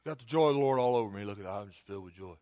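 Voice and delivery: low, quick, lifeless monotone; mock joyless testimony. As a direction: claim joy with none in the voice. Mimics joyless people in congregation.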